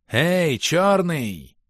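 high, loud hostile call; hateful shout. A narrator's reading. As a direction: call out an enemy with malice. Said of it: "полный злобы и ненависти".

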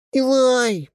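high excited call; children calling out; shout. A narrator's reading. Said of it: "многоголосый крик". (радостный крик)

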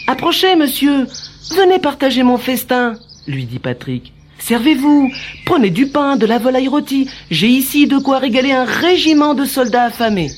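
put-on high boy voice. Patrick hosts old man.

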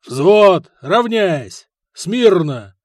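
loud barked parade orders; barked drill command. Acted. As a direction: bark it like a drill sergeant. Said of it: "скомандовал дядька".

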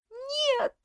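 high anguished scream of no; desperate cry. Acted. Favourite crying out at her arrest, desperate scream. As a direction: cry out in despair. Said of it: "закричала несчастная фаворитка".

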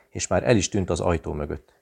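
ordinary delivery

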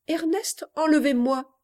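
high, startled reading of a note; astonished reading. Acted. Girl finding her own letter never posted, astonished. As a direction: read it out in disbelief, pitch jumping up. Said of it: "Que vois-je?".

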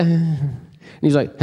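ordinary talk

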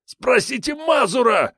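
high slow desperate wail; wailing thug. A narrator's reading. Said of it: "завопил предводитель".

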